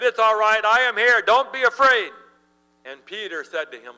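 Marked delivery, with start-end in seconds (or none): 0.0-2.1 higher, louder, calling out "It's all right"; Jesus reassuring. Acted. Jesus calling to terrified disciples, loud reassurance. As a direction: call comfort across the storm. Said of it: "And Jesus said".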